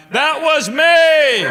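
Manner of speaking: drawn-out high call, slow and loud; yelled from the upper deck. Retold or imitated. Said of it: "yelled out".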